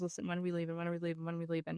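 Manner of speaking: mild mock whine, soft and repetitive; a hint of a tired child's whine; kid whining. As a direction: imitate a bored kid begging to leave, quietly. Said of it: "from the whining".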